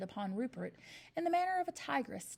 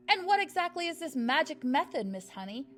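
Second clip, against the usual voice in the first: higher and louder, a lightly voiced stern headmistress; suspicious headmistress; 6 semitones higher, 6 dB louder than usual. A narrator's reading. Headmistress challenging Miss Honey about her method, suspicious. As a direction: demand it like a suspicious bully. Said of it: "asked the headmistress".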